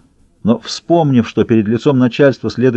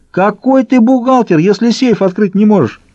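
jeering; 10 semitones higher than usual. A narrator's reading. Трубка mocks rival who cannot open a safe, jeering. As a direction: jeer at a useless rival in a high voice. Rival thugs quarrel over the job.

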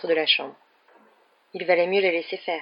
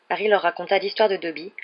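plain reading voice

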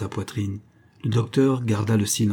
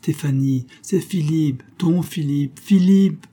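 higher pleading call building to a loud cry; desperate calling; 6 semitones higher, 4 dB louder, slower than usual. Acted. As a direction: call her name, rising to a shout. Colonel calling to Stéphanie. (appel désespéré qui monte jusqu'au cri)

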